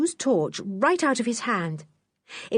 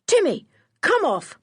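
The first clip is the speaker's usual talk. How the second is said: higher, louder order to the dog; firm command; 11 semitones higher, 4 dB louder than usual. Acted. George calling Timmy off Lou, commanding. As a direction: call the dog off sharply. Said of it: "commanded George".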